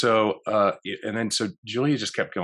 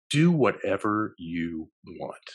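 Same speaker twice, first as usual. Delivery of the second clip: slow offer; much slower than usual. Retold or imitated. Offering full trust to Richards.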